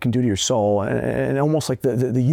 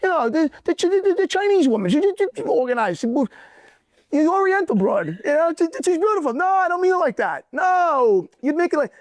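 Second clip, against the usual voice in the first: put-on much higher old grandfather voice with a different timbre; high-pitched doting grandpa giving blunt advice; Tito impression. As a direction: play your grandfather lecturing, pitched way up. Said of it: "Tito would have said".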